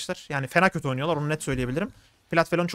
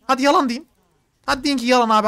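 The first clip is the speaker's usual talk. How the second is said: daring shout; 7 semitones higher, 6 dB louder than usual. Real, not acted. Challenging viewers to deny it.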